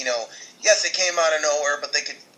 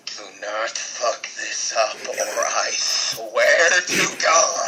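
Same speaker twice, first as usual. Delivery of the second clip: threat; 5 semitones lower, much slower than usual. Acted. Warning WWE not to ruin it.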